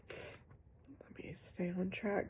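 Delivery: slow whispered aside to herself. Real, not acted. Vlogger whispers to viewers, pulling herself back on track. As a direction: hush it, reminding yourself to focus. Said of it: "queen of derailment".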